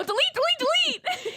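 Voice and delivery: panicked, laughing demand; frantic delete. Retold or imitated. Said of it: "I go".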